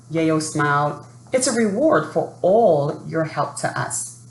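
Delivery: put-on lively storybook narration for young listeners; children's story reading. A narrator's reading. Teacher reading a story to children, lively storybook voice. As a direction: read it like a picture book at story time.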